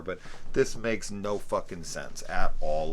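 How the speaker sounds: normal talking voice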